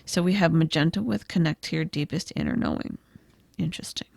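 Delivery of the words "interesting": ordinary talk, nothing marked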